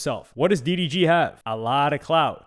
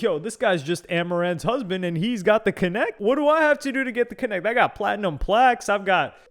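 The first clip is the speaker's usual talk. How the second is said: higher, bragging put-on voice, rushing at first; 4 semitones higher than usual. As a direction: list his trophies like a fed-up star. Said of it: "you're probably like".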